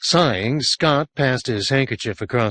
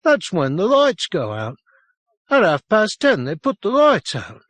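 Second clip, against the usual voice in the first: put-on higher, louder, insistent child; urgent boy; 10 semitones higher, 4 dB louder than usual. A narrator's reading. Boy insists to the sergeant, higher and louder.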